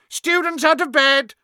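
high, very loud poltergeist bellow. A narrator's reading. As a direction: bellow it down the hall. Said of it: "Peeves bellowed".

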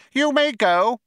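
high sharp mouse voice; curt tiny mouse dismissing him. Acted. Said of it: "said Benji Mouse sharply".

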